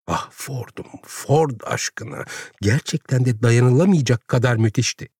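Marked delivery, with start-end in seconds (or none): 0.1-2.2 whispered start, then slow; hushed exclamation. A narrator's reading. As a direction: breathe out an appalled oath. Exclamation of disbelief in the narration. (fısıltıyla, hayretle)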